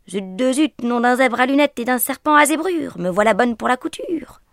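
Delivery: loud, exasperated mock cursing; mother swearing. A narrator's reading. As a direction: blurt the silly oath loud. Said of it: "jura la maman de Juju".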